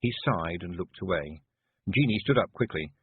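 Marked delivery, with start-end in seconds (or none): none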